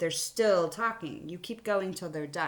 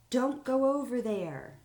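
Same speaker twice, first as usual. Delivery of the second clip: higher, slow anxious warning call; crying out; 6 semitones higher, 5 dB louder than usual. Shown as a demonstration. Teacher reads Sally crying out a warning. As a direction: read Sally's cry with alarm. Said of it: "Sally cried".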